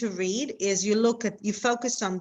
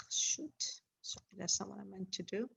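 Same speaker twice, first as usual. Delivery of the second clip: real whispered aside to self; whispering; 15 dB quieter than usual. Real, not acted. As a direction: whisper it, annoyed at yourself. Mistake muttered off-mic.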